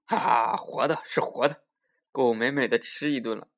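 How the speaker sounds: loud, gleeful "haha" and crowing; delighted tiger. A narrator's reading. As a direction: crow with delight over a coming meal. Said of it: "可高兴了".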